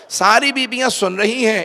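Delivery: ordinary talk, nothing marked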